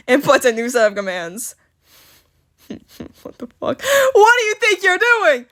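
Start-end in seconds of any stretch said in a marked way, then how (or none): 4.1-5.5 loud, high-pitched scolding; shrieky acted telling-off; exasperated yell. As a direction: yell at them in hammed-up outrage. Villain scolding his glitching drones.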